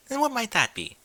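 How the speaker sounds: high, curious ladylike question; pry with coy curiosity; intrigued lady. Acted. Rarity prying into Rainbow's plans, coy.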